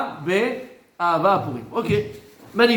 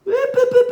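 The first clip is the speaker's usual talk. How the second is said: squeaky high hop hop hop, much higher; mock hop hop; 4 dB louder than usual. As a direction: chirp hop like a cartoon. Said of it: "comme ça, hop".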